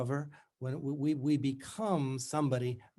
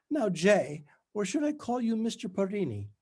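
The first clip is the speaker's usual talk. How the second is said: much higher, louder, strained bright Scottish schoolmistress; strangulated faux brightness; 8 semitones higher, 3 dB louder than usual. A narrator's reading. Tutor greets her old student, strangulated and falsely bright. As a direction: push it through gritted teeth with forced cheer. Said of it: "In her strangulated voice".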